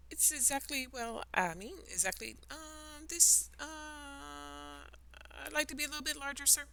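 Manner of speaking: put-on high, hesitant girl's voice, rushing the end; a girl stammering out her wish to grow; flustered Alice. Alice flustered before the caterpillar, high and stammering.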